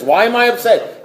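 high-pitched, mock-pleading question; retold impatient outburst; exasperated demand. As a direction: beg for the answer, pitch jumping up.

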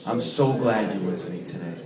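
a gentle, unhurried prayer spoken slowly. Acted. Leads group in prayer.

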